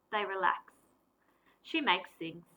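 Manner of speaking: ordinary delivery, nothing marked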